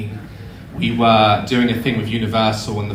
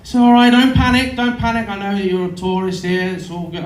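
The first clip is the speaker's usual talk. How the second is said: high-pitched mock-Australian reassurance; bad Australian accent; 6 semitones higher than usual. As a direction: soothe a tourist in hammed-up Australian. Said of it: "I can't do an Australian accent".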